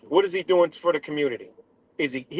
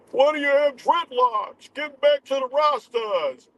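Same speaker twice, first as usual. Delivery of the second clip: mocking Zach; 10 semitones higher than usual. Mocking Zach's dreadlock comment.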